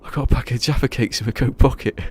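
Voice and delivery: cracking up mid-quote; laughing through the line. Retold or imitated. Retelling a Spaced joke, laughing loudly. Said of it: "And he goes".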